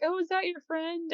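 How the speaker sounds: put-on high and soft girlish voice; Tohru's voice. Tohru asks Kyo about the cat, high soft voice. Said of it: "she's like".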